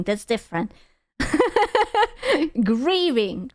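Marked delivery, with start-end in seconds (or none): 1.2-2.6 sudden high-pitched laugh; a high laugh at her own slip. Real, not acted. Laughing at her own mispronunciation, high-pitched laugh.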